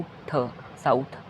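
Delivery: थ held apart then साउथ; sounding out slowly. Shown as a demonstration. Teacher sounding out south for students. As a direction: pull थ out slowly. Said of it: "T H से थ बनता है".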